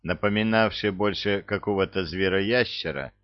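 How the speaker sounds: usual speaking voice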